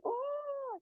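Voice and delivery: high, soft drawn-out "oh" of mock amazement; amazed gasp. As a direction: imitate an awestruck onlooker's long "oh". Imita el asombro de los testigos. ("oh" agudo de asombro fingido)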